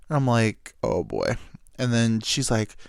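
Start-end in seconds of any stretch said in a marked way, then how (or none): 0.8-1.4 low uh-oh. Retold or imitated. Sees trouble coming.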